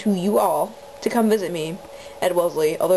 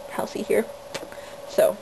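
ordinary delivery

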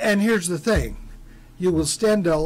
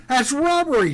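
high indignant shout; 9 semitones higher, 4 dB louder than usual. A narrator's reading. Tommy shouts at the deputy over the price, outraged. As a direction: yell it out, outraged. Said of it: "shouted Tommy".

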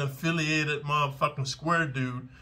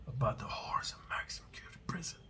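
whisper; 3 semitones higher, 13 dB quieter, faster than usual. Real, not acted. Pitching his channel.

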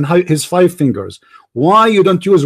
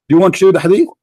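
ordinary talk, nothing marked

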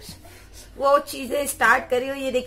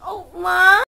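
crying out; 6 semitones higher, 10 dB louder, much slower than usual. Real, not acted. Baby cries out.

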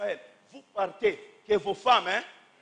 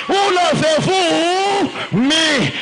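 crying plea; 6 semitones higher, 15 dB louder, much slower than usual. Acted. Jesus' question, crying.

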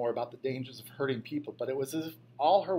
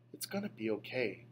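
whispered; 3 semitones lower, 6 dB quieter than usual. A narrator's reading. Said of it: "she whispered".

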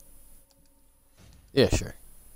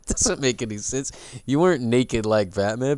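normal talking voice

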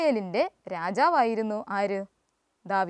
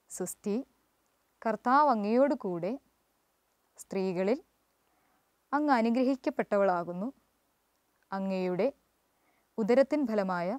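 chanted classroom prayer with pauses between phrases; prayer recitation; 3 semitones lower than usual. Acted. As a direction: lead the children through the Hail Mary reverently. Teacher leads class prayer.

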